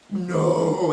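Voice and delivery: loud, drawn-out "no" of disbelief; disbelieving. Retold or imitated. Disbelief at passing, retold.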